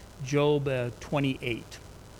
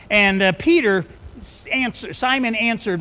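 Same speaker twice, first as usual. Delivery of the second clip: ordinary talk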